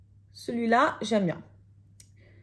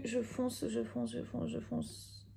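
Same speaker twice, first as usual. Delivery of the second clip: fading to a whisper; 2 semitones higher, 13 dB quieter than usual. Real, not acted. Blending darker, repeating herself.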